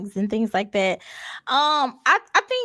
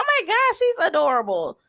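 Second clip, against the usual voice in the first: gushing; 5 semitones higher than usual. Retold or imitated. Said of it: "And I be like".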